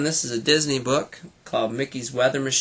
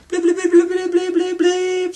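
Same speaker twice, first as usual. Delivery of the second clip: high electronic beeping, far higher than the voice; radio bleeps; 5 dB louder than usual. Acted. Radio plane beeping to call the boys, high electronic bleeps. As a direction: beep like a little machine. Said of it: "sounded Wendy's radio".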